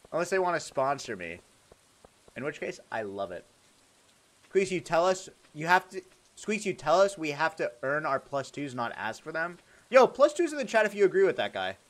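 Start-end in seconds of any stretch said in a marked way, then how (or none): none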